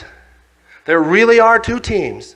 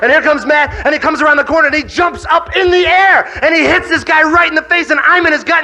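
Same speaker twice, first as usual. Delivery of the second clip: ordinary talk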